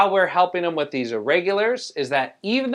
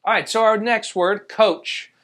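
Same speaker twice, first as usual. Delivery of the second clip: usual speaking voice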